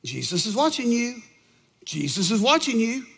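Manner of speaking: a hint of an eerie little warning voice. Parrot warning a burglar in the dark, little voice. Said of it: "he heard this little voice".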